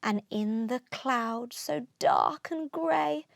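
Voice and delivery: plain reading voice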